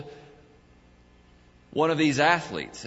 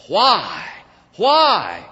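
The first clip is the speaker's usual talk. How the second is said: anguished cry; 6 semitones higher, 10 dB louder than usual. Acted. Crying out to God.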